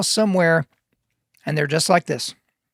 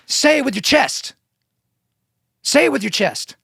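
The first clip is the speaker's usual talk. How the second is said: raised-pitch, punchy declaration; emphatic slogan; 7 semitones higher than usual. Shown as a demonstration. Podcaster announcing rule nine to guys, emphatic. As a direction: proclaim it like a rallying call.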